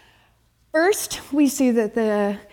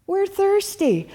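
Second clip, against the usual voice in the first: high, drawn-out whiny complaint; whining; 10 semitones higher than usual. Acted. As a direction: whine it high and slow, mimicking the thirsty crowd. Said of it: "whining and complaining hardcore".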